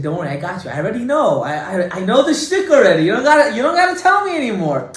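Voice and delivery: rising, insistent mock impatience; impatient wife. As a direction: play the wife cutting off his instructions. Acts out his wife answering.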